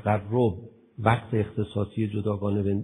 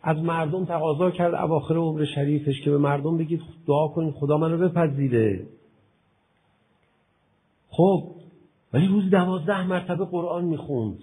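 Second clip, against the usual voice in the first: loud, fervent sermon voice; preaching at full force; 4 semitones higher, 8 dB louder than usual. Acted. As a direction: preach it hard to the hall. (با شور و صدای بلند موعظه کن)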